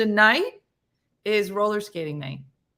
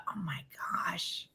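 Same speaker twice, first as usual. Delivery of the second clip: whisper; 12 dB quieter than usual. Real, not acted. Whispered reaction.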